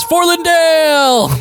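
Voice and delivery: high, slow, drawn-out rallying shout; battle cry. Acted. Fighter rallying the crowd for Lindale, drawn-out shout. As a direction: stretch it out like a war cry. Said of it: "you can hear as".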